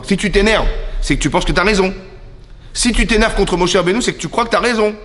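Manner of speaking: "si tu t'énerves" pitched high and insistent; emphatic point. Shown as a demonstration. Teacher explaining Caïn's anger, high and emphatic. Teacher drives home God's argument.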